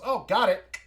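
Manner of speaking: high, loud burst of triumph; sudden exclamation. Acted. Game master acting the moment the door opens, sudden exclamation. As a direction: blurt it out, pitched right up.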